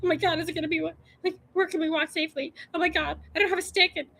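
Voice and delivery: high, anxious fretting; mock panic. Hiker scared of mountain lions, high panicky fretting. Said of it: "I'm terrified of mountain lions".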